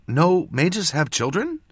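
mildly insistent, rising question; pressing. A narrator's reading. Apprentice pushing mentor for clarity, pressing. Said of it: "she pressed".

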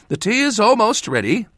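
high, doting mother voice; fond mother. A narrator's reading. Mother calling to her son across the room, doting and high. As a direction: call out sweetly like a proud mother.

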